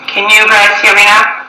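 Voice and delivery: loud, pushing the words out to be heard; calling out loud. Real, not acted. Caller straining to be heard by the host, calling out loud. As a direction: raise the voice to get through a bad line.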